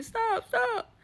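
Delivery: high screamed stop; retold scream. Friend being beaten begs the husband, high screaming. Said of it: "he's screaming".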